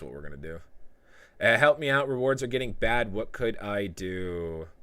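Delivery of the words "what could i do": slow, softer, lower read-out; drawn-out plea. Retold or imitated. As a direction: stretch the question out slowly, letting it sink. Reads a viewer's plea, then repeats.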